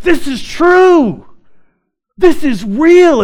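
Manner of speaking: loud, high, drawn-out exclamation; awed conviction. Retold or imitated. New believer reading the Bible, amazed conviction. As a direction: proclaim it high and slow, like a revelation. Retelling his moment of conviction.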